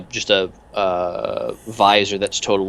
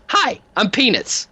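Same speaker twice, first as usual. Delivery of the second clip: much higher, slow friendly henchman hello; much higher goofy henchman greeting; Peanuts greeting; 12 semitones higher, slower than usual. Acted. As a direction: introduce yourself sweetly. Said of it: "and he goes".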